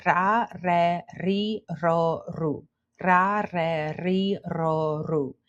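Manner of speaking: "ra, re, ri, ro, ru" with trilled R; "ra, re, ri, ro, ru" as a rhythmic trill drill; rolled R drill. Shown as a demonstration. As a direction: roll every R through the vowel series. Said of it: "Practice saying".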